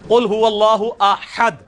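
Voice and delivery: "قل هو اللہ احد" drawn out, "احد" with throaty ha; slow recitation. Shown as a demonstration. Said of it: "احد نہیں ہے،".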